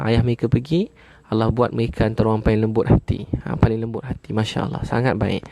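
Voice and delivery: ordinary talk, nothing marked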